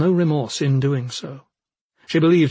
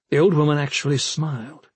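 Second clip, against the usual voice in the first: ordinary delivery, nothing marked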